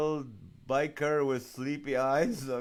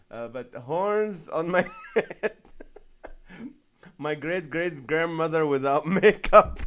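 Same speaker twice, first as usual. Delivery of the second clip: laughing; 2 semitones higher, 6 dB louder than usual. Real, not acted. Reading funny comments aloud.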